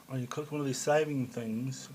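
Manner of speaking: ordinary talk, nothing marked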